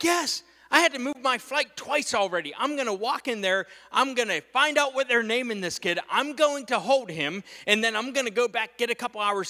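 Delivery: high, insistent self-justifying tirade; defiant rant. Retold or imitated. Husband answering his wife's doubts, defiant.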